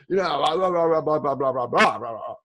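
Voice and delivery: loud blah-blah tirade, dropping in pitch; mock angry rant. Shown as a demonstration. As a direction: rant nonsense as if triggered. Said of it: "something makes you angry".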